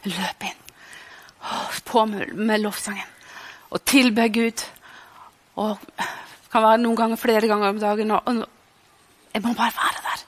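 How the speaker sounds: ordinary talk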